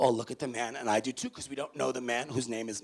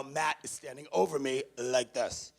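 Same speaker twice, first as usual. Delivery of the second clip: normal talking voice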